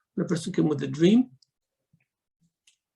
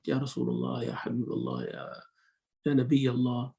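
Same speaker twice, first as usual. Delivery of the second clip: softly and respectfully, a bit quieter; polite address; 5 semitones lower, 5 dB quieter than usual. Shown as a demonstration. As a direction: address the Prophet gently and humbly. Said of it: "It has to be polite".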